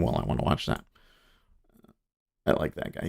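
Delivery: whispered aside to himself; whispering. Real, not acted. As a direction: breathe it under your breath. Muttering aside while browsing.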